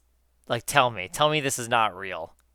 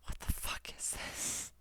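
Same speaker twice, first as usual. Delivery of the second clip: whisper; 16 dB quieter, slower than usual. Real, not acted. Shocked at a dog picture.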